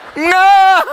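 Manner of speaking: put-on high, loud frightened child's no; a scared little boy flatly refuses; child refusing. Son refusing his father, high and loud.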